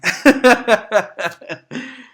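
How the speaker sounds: loud high burst of laughter. Real, not acted. Laughing at his own mock quitter voice, loud burst. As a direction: crack up at your own joke.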